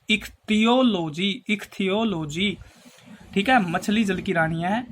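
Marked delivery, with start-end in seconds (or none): 0.1-2.6 ichthyology slowly split as इक-थियो-लो-जी; syllable by syllable. Shown as a demonstration. Teacher helping students memorise the term, ichthyology slowly split up. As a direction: break ichthyology into slow, separate parts.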